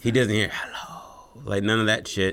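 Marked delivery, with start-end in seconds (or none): 0.5-1.4 whispered spooky hello; ghostly whisper. Retold or imitated. Ghost greeting from the hidden room, whispered. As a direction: breathe it like a ghost, no voice.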